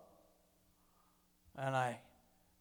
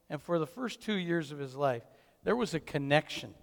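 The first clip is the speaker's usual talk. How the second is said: usual speaking voice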